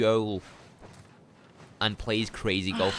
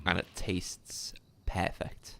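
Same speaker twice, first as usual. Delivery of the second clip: whispering; 8 dB quieter, slower than usual. Pretend. Hushed, ad-like tag to his order.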